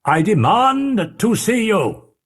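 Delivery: stern demand. Acted. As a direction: warn him gravely, each word weighed. Utterson threatens through the door.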